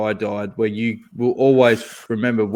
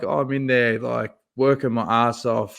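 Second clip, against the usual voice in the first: usual speaking voice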